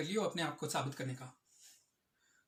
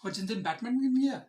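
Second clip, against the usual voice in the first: ordinary talk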